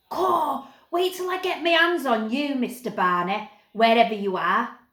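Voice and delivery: high, loud cocky threat from a rough lad; eldest Snaggart threatening. A narrator's reading. Eldest Snaggart threatens the hidden Barney, loud bullying call. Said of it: "came the voice of the eldest Snaggart".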